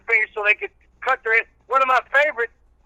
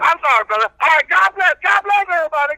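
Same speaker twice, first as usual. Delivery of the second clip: country preacher character; 8 semitones higher, 4 dB louder than usual. Acted. Old reverend preaching patience to the radio host, in full preacher voice.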